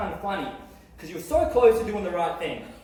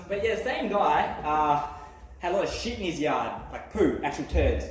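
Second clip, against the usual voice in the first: ordinary talk, nothing marked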